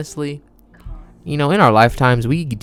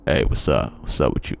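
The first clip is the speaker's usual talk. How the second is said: low, gruff dude voice, well below his own; mock a grumpy dude greeting; lightly hammed grumpy guy; 10 semitones lower, 3 dB quieter than usual. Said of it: "am I supposed to sound like that?".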